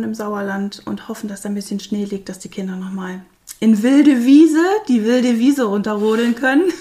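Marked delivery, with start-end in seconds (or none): 3.6-6.8 laughs out loud with delight; laughing. Real, not acted. Mother picturing kids sledging, laughing out loud. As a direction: breaks into happy laughter at the thought.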